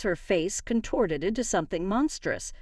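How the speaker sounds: ordinary delivery, nothing marked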